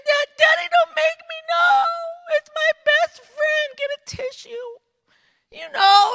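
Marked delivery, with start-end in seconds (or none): 0.0-4.7 high-pitched, hammed-up child's wail; tearful little girl. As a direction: plead loudly through mock tears. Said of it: "little girl with tears just streaming".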